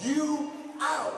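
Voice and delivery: rising, shouting sermon build; full-force preaching. Acted. Pastor rousing the church, full-force preaching. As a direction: preach it up to a roar.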